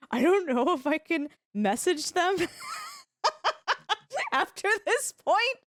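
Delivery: high, hesitant mock-shy voice, broken by a laugh; shy bean. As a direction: act out bashful dithering, giggling at yourself. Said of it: "I felt like such a shy bean".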